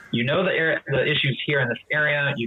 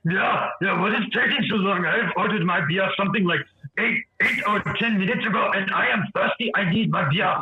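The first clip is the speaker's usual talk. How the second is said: higher, pushy voice of an impatient giant; agitated Goliath; 4 semitones higher than usual. Acted. Goliath complaining to the barkeep, impatient. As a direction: demand your beer, fed up. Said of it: "He's like".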